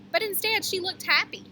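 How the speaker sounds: usual speaking voice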